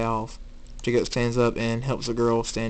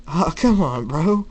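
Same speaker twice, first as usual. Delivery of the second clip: raised, higher-pitched teasing plea; playful protest; 6 semitones higher, 4 dB louder than usual. Acted. Firefly calls back his annoyed friend, loud playful protest. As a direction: protest loudly that it was only teasing.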